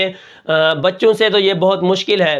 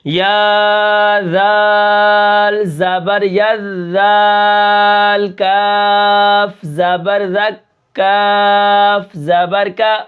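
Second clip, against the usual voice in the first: slow spelling drill; 2 semitones higher, much slower than usual. Shown as a demonstration. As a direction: spell each letter out like a teacher. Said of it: "اب یہاں پر دیکھیں". (استاد کی طرح آہستہ ہجے)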